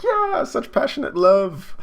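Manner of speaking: high, slow, loud squeal; hammed-up fangirl swoon; swooning onlookers. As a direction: gush over the lovers in a stretched high voice.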